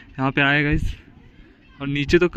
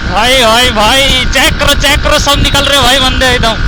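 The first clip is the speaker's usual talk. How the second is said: loud excited yelling; 7 semitones higher, 9 dB louder, slower than usual. Real, not acted. Yelling over wind during race.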